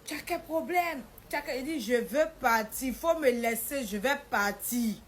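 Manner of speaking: loud, drawn-out insistence; angry retort. Retold or imitated. Wife quarrelling to be let go, angry and loud. Retold marital quarrel about leaving.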